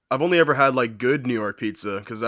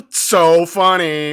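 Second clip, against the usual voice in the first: sarcastic; 3 semitones higher, 7 dB louder, much slower than usual. Real, not acted. Sarcastic reaction.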